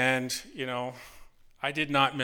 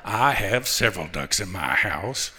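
Morgan Freeman; 5 semitones lower than usual. Acted. Customer toying with a telemarketer about ducks, Morgan Freeman impression. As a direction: draw it out low and unhurried, with pauses. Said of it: "maybe Morgan Freeman or something like that".

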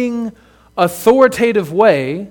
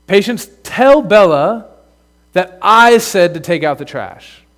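slow, firm, tell leaned on; parent instruction demo; 4 dB louder, slower than usual. Shown as a demonstration. Dad showing how he words chores to his kids, slow and firm. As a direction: lay it out slowly, weight on "tell". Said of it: "I'll say".

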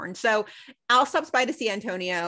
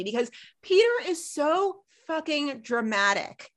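usual speaking voice

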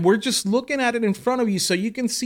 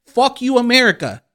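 louder, higher mock curse; mock curse at America; 6 semitones higher, 6 dB louder than usual. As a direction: spit the president's insult at the whole country. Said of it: "No, he said".

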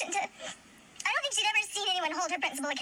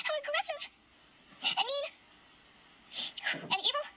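upset; 5 semitones higher than usual. Real, not acted. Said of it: "this is so upsetting".

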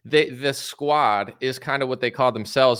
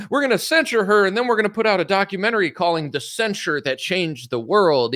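mock politician; 7 semitones higher than usual. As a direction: puff up like a proud grandstander. Mocking Republicans bragging about censure.

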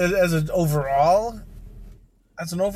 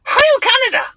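much higher, louder hammed-up rally cry; mock salute shout; 7 dB louder than usual. Joking parody shout among friends.

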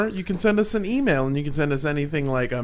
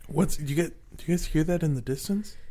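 ordinary talk

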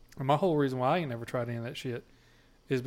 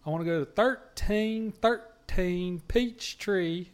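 slow, careful, higher southern voice; toned-down southern accent; 6 semitones higher, 3 dB louder, much slower than usual. Retold or imitated. A southerner giving Siri an address, slow and careful in a southern accent. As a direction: spell it out slowly for the phone in a tamed southern accent. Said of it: "tame down my southern accent".

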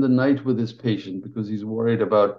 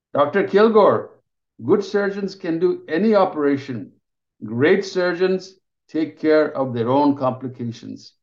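booming praise; 5 semitones higher than usual. Acted. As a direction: announce it so the whole ward hears. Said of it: "His voice fills the ward".

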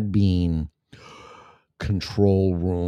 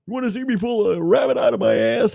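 much higher, fast cartoon squeak; 14 semitones higher, 4 dB louder, faster than usual. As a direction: chirp like a cartoon critter. Cartoon character impression.

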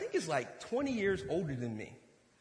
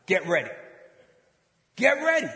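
booming acted call to action; rally them with a loud, punchy order; loud command; 9 dB louder than usual. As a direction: announce it forcefully. God's command, called out loudly.

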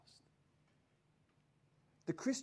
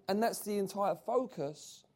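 ordinary talk, nothing marked

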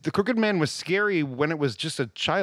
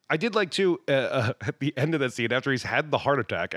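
ordinary talk, nothing marked

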